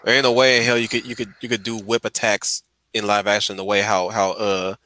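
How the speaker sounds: usual speaking voice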